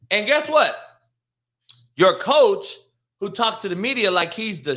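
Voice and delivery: spring the accusation with a leap in pitch; indignant outburst. Real, not acted. Rant turns on the coach.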